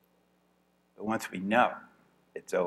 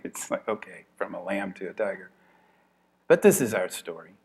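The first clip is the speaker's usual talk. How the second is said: ordinary delivery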